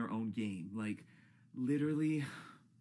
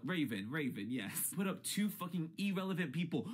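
normal talking voice

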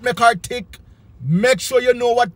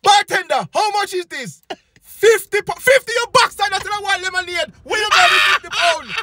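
outraged at the price; 11 semitones higher than usual. Retold or imitated. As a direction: yell in disbelief at fifty pounds.

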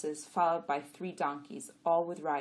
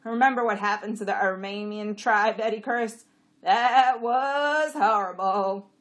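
loud, raised donkey voice; dismayed donkey; 5 semitones higher, 12 dB louder than usual. A narrator's reading. Jack recalling the cursed tribe, loud and dragging. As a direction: stretch out the dismay loudly.

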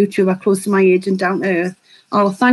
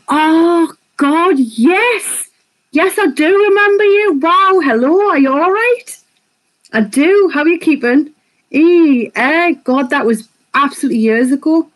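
much higher, bright happy greeting; excited recognition; 7 semitones higher than usual. Real, not acted. Greets remembered viewer excitedly.

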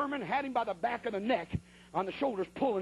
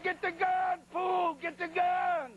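higher, very slow, drawn-out shout; desperate yell; 9 semitones higher, much slower than usual. Retold or imitated. Preacher shouts at laughing Herman to fetch the gun, drawn-out yell. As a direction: stretch out every word in exasperation.

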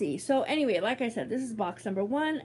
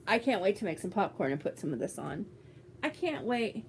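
usual speaking voice

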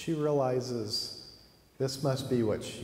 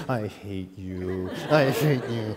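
hammed-up child's tantrum, louder on the repeat; childish spite; 5 dB louder, slower than usual. Girl left alone with the plant, childish spite. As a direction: drag it out with comic spite. Girl's tantrum at grandfather's back.

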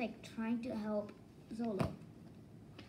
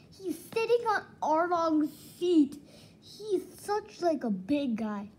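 excited praise; 5 semitones higher, 7 dB louder than usual. Real, not acted. Excited about Zolo.

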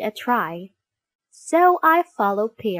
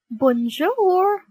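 higher-pitched, drawn-out bonjour; calling out; 9 semitones higher, 4 dB louder than usual. Acted. Dog surprises another dog with a greeting, calling out. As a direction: call it out like a greeting. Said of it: "Did I just hear some dog".

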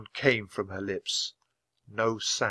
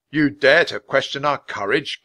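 indignant king, louder and higher; affronted royal retort; angry challenge; 5 semitones higher, 7 dB louder than usual. A narrator's reading. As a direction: challenge the insult with restrained anger. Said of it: "said in a low, angry voice".

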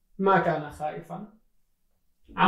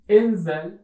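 a louder firm order; commanding; 7 dB louder than usual. Acted. Uncle orders Aladdin into the hole, loud and firm. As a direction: bark it as a stern uncle.

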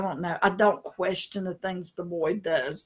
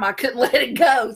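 ordinary delivery

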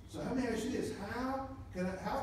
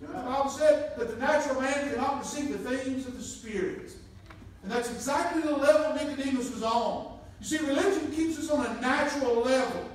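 full-force preaching; 7 semitones higher, 7 dB louder than usual. Acted. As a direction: press the point home to the congregation.

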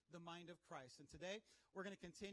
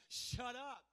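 raised, loud, punchy order; aggressive command; 5 semitones higher, 6 dB louder than usual. Shown as a demonstration. Believer pointing at the wave, aggressive command. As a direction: order it away with force. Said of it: "learn how to be aggressive".

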